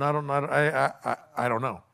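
halting, trailing-off feigned ignorance; evasive stammer. Acted. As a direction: stumble over it, dodging the question. Acted evasive messenger stammering to king.

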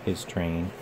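slow, soft musing to himself; Thomas musing. Acted. Thomas dreaming of pulling Henry's train, slow and soft. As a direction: savour the thought slowly, lightly voiced. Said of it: "he thought".

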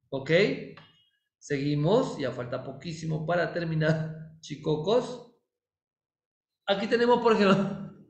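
normal talking voice

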